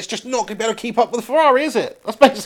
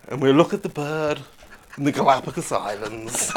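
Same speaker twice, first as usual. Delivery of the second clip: low, hushed documentary narrator; mock nature-presenter voice; David Attenborough; 7 semitones lower, 8 dB quieter than usual. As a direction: narrate softly like a wildlife film. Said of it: "Sir David Attenborough".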